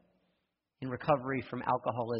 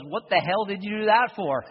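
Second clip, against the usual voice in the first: loud, high, incredulous scolding; what were you thinking, said loud; stuck man snapping at his friend; exasperated outburst; 9 semitones higher, 11 dB louder than usual. Retold or imitated.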